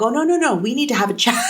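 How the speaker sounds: lightly playful teacher, pitched high on the no's; a hint of a firm teacher stopping the kids; teacher stopping a student. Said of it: "And so I'm like".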